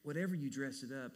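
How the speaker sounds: ordinary talk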